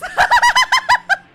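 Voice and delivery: high squealing burst of laughter; loud shrieking laugh. Real, not acted. Streamer bursts out laughing at chat. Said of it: "Wasn't expecting to read chat in the voice".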